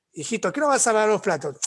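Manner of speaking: a mother running out of patience; impatient mother. Retold or imitated. Mother losing patience in example.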